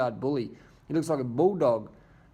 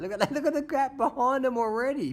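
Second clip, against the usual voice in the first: high-pitched excited outburst; thrilled cycling commentator; excited commentary; 12 semitones higher than usual. Real, not acted. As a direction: voice leaps up high, amazed at the action. Excited reaction to the gap opening.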